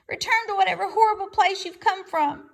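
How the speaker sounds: high, loud weasel demand; squeaky demand. A narrator's reading. Weasel shoos the robot away, high loud voice. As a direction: squeak it out, shooing. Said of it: "squeaked Dart".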